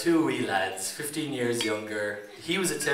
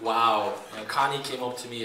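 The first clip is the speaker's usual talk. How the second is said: ordinary talk, nothing marked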